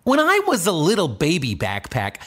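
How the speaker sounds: normal talking voice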